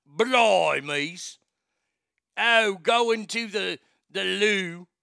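loud, drawn-out mock British; hammed-up Cockney-style British exclaiming; British accent. Shown as a demonstration. Host demonstrating starter phrases, hammed-up British accent. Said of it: "you can do a British accent too".

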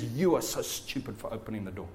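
low, quiet, lightly voiced taunt; accusing devil. Devil turning on you once he's in, quietly accusing. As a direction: sneer it softly as the accuser. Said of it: "then he accuses you".

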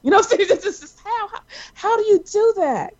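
high, incredulous marvelling; stunned by the writing, how how; amazed. Real, not acted. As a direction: squeal how in disbelief.

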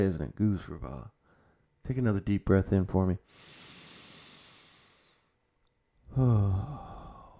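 half-joking calm breathing coach; mock guided meditation. Vlogger calms his viewers after a rant, mock meditation. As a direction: talk them down into a breath, lightly.

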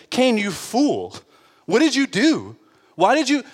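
high, stern scolding outburst; angry rebuke. Pretend. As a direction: scold him like a furious judge. God condemning Cain, imagined.

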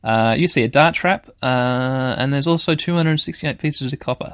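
ordinary delivery

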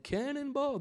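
high, drawn-out mock leap call; playful whoop. Sailors tossing Jonah into the sea, playful high whoop. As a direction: swoop up high and stretch it out. Acts out throwing Jonah overboard.

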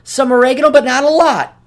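mock spoiled customer. Mocking a spoiled customer ordering salt, high prissy voice. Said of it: "your life that you're like".

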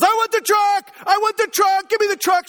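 high, repetitive mock tantrum yell; yelling kid. Son defies dad's warning in the toy section, yelling. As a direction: holler high like a demanding little boy. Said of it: "If you keep yelling like this".

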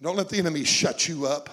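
usual speaking voice

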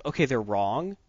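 normal talking voice

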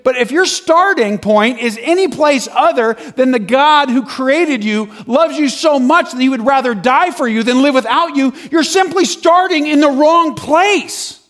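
high, loud, preaching intensity; re-enacted open-air sermon, building to the end; impassioned rebuttal. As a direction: drive the point home to the whole crowd. Retold speech to preacher and crowd.

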